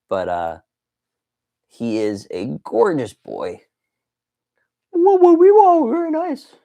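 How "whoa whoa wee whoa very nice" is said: very high, loud squeaky outburst; silly high-pitched cartoon voice. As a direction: squeal it in a put-on silly falsetto. Playful toy voice over figure.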